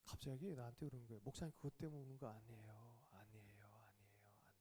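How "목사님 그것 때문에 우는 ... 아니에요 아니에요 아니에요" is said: whispered, softer tearful denial. Retold or imitated. Weeping brother telling the pastor why he cries, whispered tearful denial. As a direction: hush it like a man still crying.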